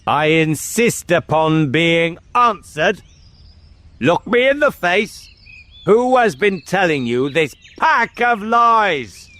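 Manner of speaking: high-pitched, insistent royal demand; angry interrogation. Acted. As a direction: demand an answer from a frightened child. Enraged king interrogates the boy.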